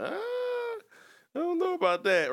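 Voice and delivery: high, drawn-out reluctant "ah"; doubtful reluctance. Retold or imitated. Turning down his boss's coaching offer, reluctant and doubtful. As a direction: brush off the idea, unsure of yourself.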